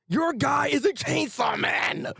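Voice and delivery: high, drawn-out indignant shout; upset outburst. Acted. Acting out an upset manga character, high indignant shout. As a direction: cry it out slowly and high, outraged. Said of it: "he is so upset about this".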